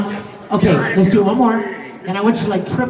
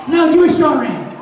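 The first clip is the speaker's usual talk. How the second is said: mock fan demand; 8 semitones higher, 4 dB louder than usual. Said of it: "every single person's like".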